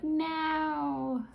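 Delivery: high, drawn-out mock Australian; Australian accent. Shown as a demonstration. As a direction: wail it in an exaggerated Aussie accent. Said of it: "I'm gonna stop doing it now".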